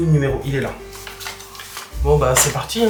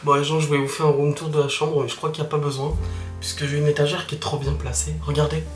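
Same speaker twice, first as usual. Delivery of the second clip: softer, keeping it down for thin walls; hushed so the neighbours won't hear; quiet voice; 3 semitones lower, 6 dB quieter than usual. Real, not acted. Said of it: "j'ose pas parler fort du tout".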